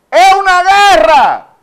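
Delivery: full-force, high-pitched shout; preacher's shout. Real, not acted. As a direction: cry it out to the whole congregation. Preacher cries out the key phrase. (grito de predicador)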